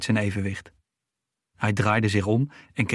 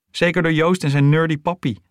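loud, raised-pitch taunt; jeering call; 7 semitones higher, 6 dB louder than usual. A narrator's reading. Bully jeers at classmate about his dad, loud mocking call. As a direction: call it out higher and louder, mocking. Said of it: "riep Jeffrey".

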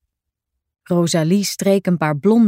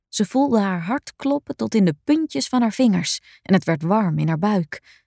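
ordinary delivery, nothing marked

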